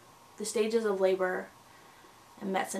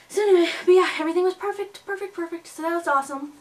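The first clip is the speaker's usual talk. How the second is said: bright burst of happy news, much higher and louder; excited; 8 semitones higher, 6 dB louder, faster than usual. Real, not acted. Telling viewers the checkup went perfectly, excited. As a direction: gush about the perfect checkup. Upbeat recap of good news.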